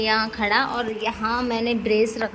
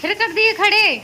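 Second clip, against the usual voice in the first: playful cooing; 9 semitones higher, 3 dB louder than usual. Real, not acted. Wife cheering her husband standing up, high doting coo. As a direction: coo at him like a proud mother.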